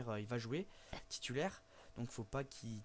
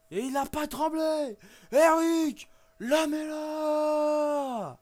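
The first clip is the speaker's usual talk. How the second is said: high, loud, name stretched out slowly; goal celebration yell; 13 semitones higher, 14 dB louder, much slower than usual. Real, not acted. Player celebrating his scored penalty, drawn-out triumphant yell. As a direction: roar the scorer's name like a commentator.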